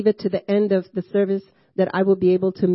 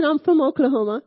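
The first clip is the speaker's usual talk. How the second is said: put-on Oklahoma accent, pitched higher; Okie accent; 6 semitones higher than usual. Answering curious clients, she plays up an Okie Oklahoma accent. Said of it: "That's my Okies accent".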